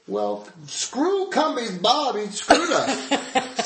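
high, slow, hammed-up indignation; mock outrage. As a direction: ham up being betrayed. Mock outrage at unanswered call.

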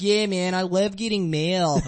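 slow, stretched Philadelphia vowels; Philly accent. Retold or imitated. Comic quoting a woman from Race Wars, mock Philly accent. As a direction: lightly imitate a Philly girl's accent. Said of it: "they call a Philly girl".

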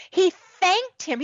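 thanked said high and slow, stressed. Real, not acted. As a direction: lean hard on thanked, slowly. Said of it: "as if it was something that deserved thanks".

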